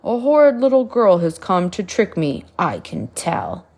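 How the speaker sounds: low, loud giant voice with a boom on the first words; giant bellow. A narrator's reading. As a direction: bellow it out like a big grumpy giant. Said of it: "The giant bellowed".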